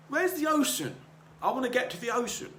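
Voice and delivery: put-on high excited young goldfish asking the old one. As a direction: pipe it up like a small curious fish. Young goldfish voiced in story.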